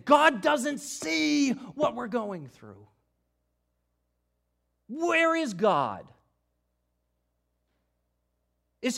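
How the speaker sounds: loud, slow, pleading cry to God; anguished plea. Acted. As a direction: voice the despair of exiles far from home. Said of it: "Rather, they were saying".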